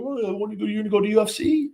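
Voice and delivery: high, quick, mocking imitation; mock naive questioner. Imitating people who won't listen about UFC, mock naive questioner.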